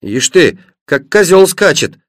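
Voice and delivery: usual speaking voice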